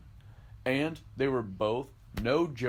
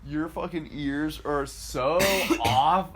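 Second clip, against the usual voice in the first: loud, raised, laughing rebuke; mock scolding; 5 semitones higher, 5 dB louder, slower than usual. Mover scolds coworker for misjudging her, loud mock outrage. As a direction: scold slowly and loudly through laughter.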